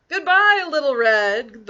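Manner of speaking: loud, high-starting call that drops; wolf calling after. A narrator's reading. Wolf calling after Little Red as she leaves, calling out. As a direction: call out a farewell into the distance. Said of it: "the wolf called after her".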